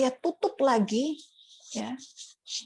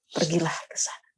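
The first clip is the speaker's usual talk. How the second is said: gentle, loving voice; 4 semitones lower than usual. Retold or imitated. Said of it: "Suara yang begitu lembut, penuh kasih, dan berotoritas".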